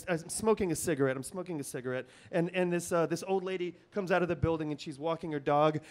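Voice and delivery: ordinary talk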